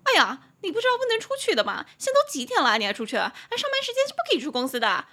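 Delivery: high, loud, fast scolding lady voice; mock the busybody scolding you; imitating the meddling coworker scolding about going out; nagging coworker. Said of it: "然后他就直接跟我讲说".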